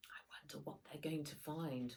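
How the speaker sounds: hushed whisper to the listener; soft unvoiced aside. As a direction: lean in and whisper the secret.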